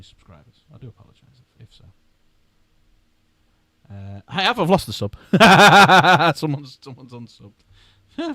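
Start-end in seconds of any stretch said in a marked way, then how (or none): 5.2-6.3 big loud laugh, higher; loud burst of laughter. Real, not acted. Laughing loudly on seeing a subscriber left.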